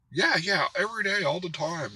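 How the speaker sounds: imitating his breezy claim, pitched high; mock casual bragging. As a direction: toss it off high, like a bluffer. Acting out the man's boastful claim.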